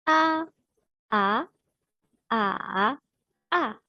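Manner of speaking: "A" level, rising, dipping, then falling; four tones in turn. Shown as a demonstration. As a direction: Mandarin tone drill on "A". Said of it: "directement les quatre tons".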